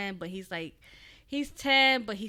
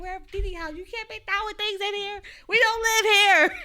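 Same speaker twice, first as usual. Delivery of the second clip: higher and building to a loud scold; rising frustration, louder each line; scolding mom; 12 semitones higher, 10 dB louder than usual. Retold or imitated. Mom scolding her angry son at Didi's house, building. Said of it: "I was like".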